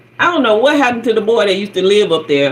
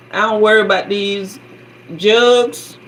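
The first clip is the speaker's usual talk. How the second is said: ordinary talk, nothing marked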